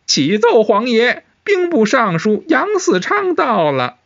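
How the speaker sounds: very high, slow, drawn-out announcement; high-pitched palace attendant announcing a visitor; eunuch herald. A narrator's reading. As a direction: call out to the throne in a high, stretched cry.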